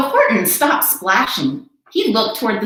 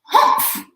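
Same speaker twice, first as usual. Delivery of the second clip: high breathy scoffing humph; sour humph; 4 dB louder than usual. Acted. Sour kangaroo scoffs at Horton, breathy high humph. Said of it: "humphed a voice".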